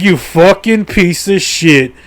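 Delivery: acted indignant insult, stretched slowly; angry retort. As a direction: drag out each word in mock outrage. Angry answer to helicopter man.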